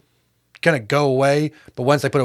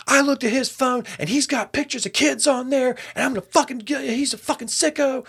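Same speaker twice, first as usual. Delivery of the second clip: retold frantic accusation; 9 semitones higher than usual. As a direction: act out a worked-up accuser bursting in.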